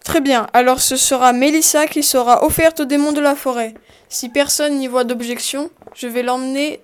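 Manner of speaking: loud, projected announcement; public proclamation. Acted. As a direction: proclaim it to the whole crowd.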